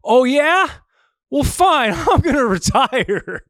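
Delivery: high, loud, bratty huff with a laugh; hammed-up high tantrum voice; mock petulant Draymond. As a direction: squeak it out like a sulking kid quitting. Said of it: "Draymond was like".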